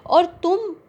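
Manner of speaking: high, trembling angry question; voice shaking with anger. Acted. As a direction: let the rage shake your voice. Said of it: "गुस्से में आवाज़ कांपती हुई".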